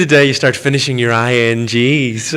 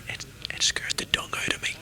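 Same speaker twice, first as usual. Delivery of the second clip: hushed, scared whisper; 14 dB quieter than usual. Real, not acted. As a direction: whisper it as if the dinosaurs might hear. Dinosaurs scare him.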